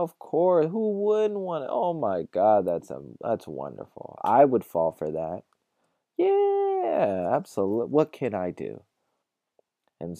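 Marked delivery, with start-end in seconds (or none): 0.0-4.2 high, loud gush of delight dropping back at the end; gushing excitement. Retold or imitated. Acting out delighted hotel staff agreeing. 6.2-8.8 very high, loud drawn-out yes, then eager offer; gushing eagerness. Retold or imitated. Acting out eager staff offering help.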